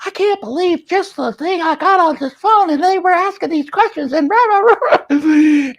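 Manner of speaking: high-pitched tattling whine; hammed-up childish tattle, pitch way up; whiny complaint. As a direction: run to mommy with the grievance. Imitating himself running to mommy.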